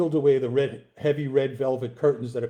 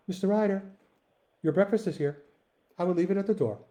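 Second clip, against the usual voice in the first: higher, gentle voice of a landlady; lightly voiced sweet lady at the door; sweet lady's voice; 6 semitones higher, faster than usual. A narrator's reading. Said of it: "A sweet lady's voice".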